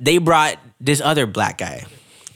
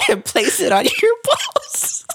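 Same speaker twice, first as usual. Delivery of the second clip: laughing. Retold or imitated. Quoting absurd instruction, laughing.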